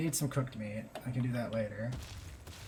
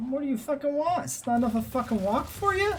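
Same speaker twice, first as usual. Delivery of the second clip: exasperated yelling; 9 semitones higher than usual. Real, not acted. Yelling at stubborn dinosaur pet.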